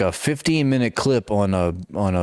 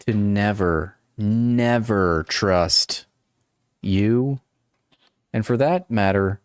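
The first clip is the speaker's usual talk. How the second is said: slow, drawn-out mock vow of distrust; hammed-up wounded declaration, word by word; mock betrayed; slower than usual. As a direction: stretch it out with long dramatic pauses. Mock betrayal addressed to Saeed.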